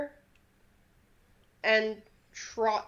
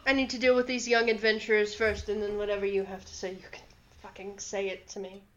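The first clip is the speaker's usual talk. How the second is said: rapid delivery, dropping softer toward the end; brisk dismissal; 4 dB quieter, much faster than usual. Acted. Archfey puts off the red-headed woman, brisk dismissal.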